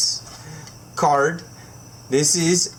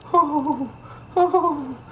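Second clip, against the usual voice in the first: emotional; 10 semitones higher than usual. Real, not acted. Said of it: "I'm getting emotional right now".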